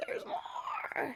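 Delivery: high, far-off unvoiced whisper; stunned whisper. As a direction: gasp it out in disbelief. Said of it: "And I was like".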